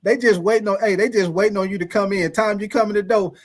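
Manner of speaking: ordinary delivery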